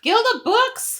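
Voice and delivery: high, loud, drawn-out disbelief; incredulous outburst. Real, not acted. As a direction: blurt it back as a stunned question. Reacts to the surprising guild name.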